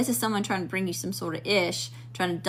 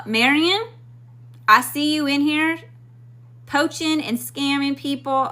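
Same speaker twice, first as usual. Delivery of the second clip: loud, higher, slow confrontation; calling out a scammer; 6 semitones higher, 3 dB louder, slower than usual. Real, not acted. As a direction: address the scammer head-on, slowly.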